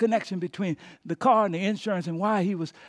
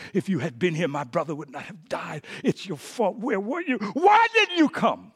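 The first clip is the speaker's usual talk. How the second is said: crying, screaming accusation; 3 semitones higher, 3 dB louder than usual. Acted. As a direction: start contained, climb to a loud anguished demand. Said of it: "she's crying and screaming at him".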